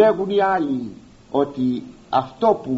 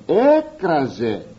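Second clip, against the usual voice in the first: «έκραζε» stretched slowly and leaned on; «έκραζε» slow and deliberate; slow emphasis; much slower than usual. Shown as a demonstration. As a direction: draw out «έκραζε» to show its weight. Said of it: "πολλό μάλλον".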